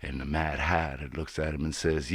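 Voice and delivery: ordinary delivery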